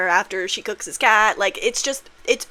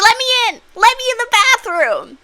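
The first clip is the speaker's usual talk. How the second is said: shrieking high and loud through the door; frantic screaming; 14 semitones higher, 10 dB louder than usual. Retold or imitated. Girlfriend screaming at him through the bathroom door, frantic shriek. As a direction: pound on the door and scream to be let in.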